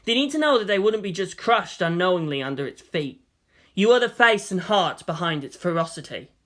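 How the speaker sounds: ordinary delivery, nothing marked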